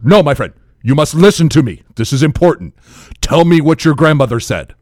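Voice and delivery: loud, urgent imitated African accent; thick African accent. Drunk African man insisting to a new friend, loud thick African accent. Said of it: "his accent was very thick".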